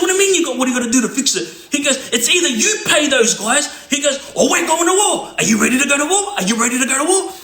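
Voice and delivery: high, heated threat; imitated angry ultimatum; threatening. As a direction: push him hard, voice up high. Elder threatening war.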